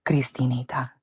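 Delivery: slow, breathless whisper of a frail grandmother. Retold or imitated. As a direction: whisper it slowly like her final words. Dying grandma's last words retold.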